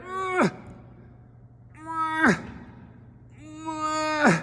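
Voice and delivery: high strained grunts, drawn out; mock straining noises. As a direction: grunt like someone straining in the dark. Heard son straining outside.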